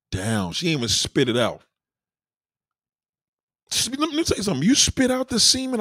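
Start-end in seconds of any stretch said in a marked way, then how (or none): 3.7-5.8 high excited rant. Acted. Launching into rant.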